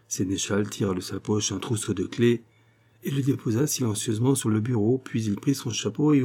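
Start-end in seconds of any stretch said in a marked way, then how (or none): none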